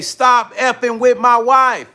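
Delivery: imitating an angry accuser; retold angry warning. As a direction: draw out each word like an irate husband. Retold angry confrontation by stranger.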